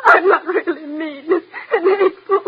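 sobbing wail, high and despairing; crying. Acted. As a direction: voice rising into a wailed sob. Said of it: "stop crying".

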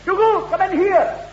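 high-pitched summoning call. Acted. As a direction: call a servant in from the next room. Laird calls out for servant.

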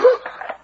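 sharp unvoiced intake of breath; shocked gasp. Guard learns of the disguised Englishman, shocked gasp. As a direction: gasp in alarm.

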